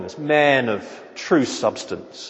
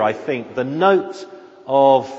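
normal talking voice

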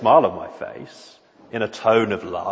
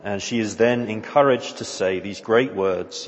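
normal talking voice

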